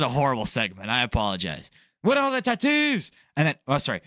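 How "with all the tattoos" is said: high-pitched, badly faked Irish accent; hammed-up high Irish voice; mock Irish wife. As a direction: mimic a wife in a silly Irish falsetto. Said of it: "I don't know what l-, accent".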